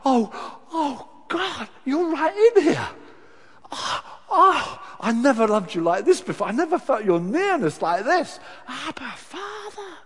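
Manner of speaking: high, loud, overwhelmed cry to God; ecstatic prayer. Retold or imitated. Preacher reliving his Spirit baptism, crying out ecstatically. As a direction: pour it out like being filled with the Spirit. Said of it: "I thought".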